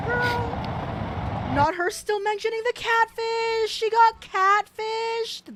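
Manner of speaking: excited gossip. Real, not acted. Gleefully gossiping about the catfish.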